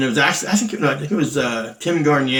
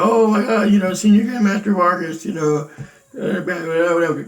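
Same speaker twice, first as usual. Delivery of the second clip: higher, louder gushing over Senior Grandmaster Vargas; mock excited gushing; 5 semitones higher, 3 dB louder than usual. Imitating Trent Junker greeting them, mock gushing and higher. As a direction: imitate a fawning host, all breathless delight. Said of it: "he was so happy to see us".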